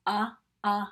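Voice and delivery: uh, uh as two short uhs like umbrella; short u sounds. Shown as a demonstration. Teacher leading the vowel chant, short u. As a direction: grunt out the short u twice. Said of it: "as I say the sound".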